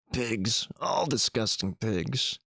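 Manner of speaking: put-on slow, bitter muttering old man; cursing old man. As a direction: spit the insult out slowly behind the door. Old man cursing behind door.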